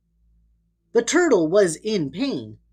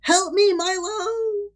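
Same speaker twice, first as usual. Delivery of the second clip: high, far-off call for help; a high voice calling from a distance; distant cry for help; 12 semitones higher, slower than usual. A narrator's reading. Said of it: "a voice shouted".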